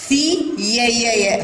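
slow ثي then repeated distinct "ya" for يا; yaa pronounced. Shown as a demonstration. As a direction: bounce on each يا, syllable by syllable. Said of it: "هنا الياء تنطق ولا تمد". (نطق يا مكررة بوضوح بعد ثي)

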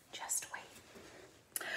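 soft whispered "just wait"; gentle quiet voice. Acted. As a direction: breathe it softly, gentle and calm. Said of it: "gentle, quiet voice said".

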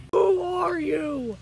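slow loud demanding shout; booming challenge. Acted. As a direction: bellow it slowly.

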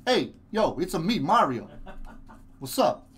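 put-on Mario in a Brooklyn accent. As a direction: tough Brooklyn guy Mario. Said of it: "hear his Brooklyn accent".

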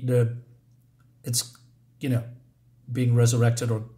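usual speaking voice

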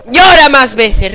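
loud, high, slow drawn-out plea; loud pleading. Real, not acted. Gamer begging the baby to cry again, loud and slow. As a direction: stretch out the begging at the screen.

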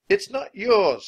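high, loud protest; indignant high-pitched yell; shouting. A narrator's reading. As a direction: shout defiantly at a pirate. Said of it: "shouted Annie".

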